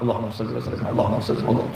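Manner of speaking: whispered sholawat to oneself; quiet sholawat. Shown as a demonstration. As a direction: murmur the blessing almost to a whisper. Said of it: "Jenengan sholawat".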